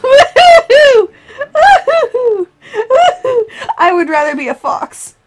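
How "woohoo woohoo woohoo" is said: high wailing woohoo; spooky mock-ghost wail; playful ghostly hoot. Player turned ghost in game, ghostly hooting.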